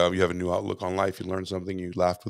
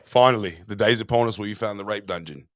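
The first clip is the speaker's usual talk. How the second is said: ordinary talk, nothing marked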